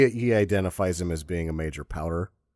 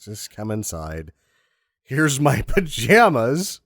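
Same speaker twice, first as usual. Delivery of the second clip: mock coaxing; 5 semitones higher, 5 dB louder, slower than usual.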